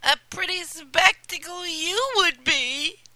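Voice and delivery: high, loud sneering stepsister; scornful sister voice, pitched high and loud; rude. Acted. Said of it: "they said rudely".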